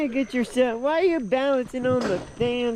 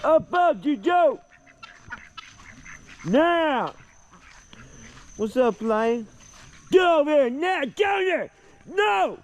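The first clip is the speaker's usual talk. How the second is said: high sharp commands to a dog; calling from far away; 6 semitones higher, slower than usual. Real, not acted. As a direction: call the dog off from a distance. Chasing the dog off.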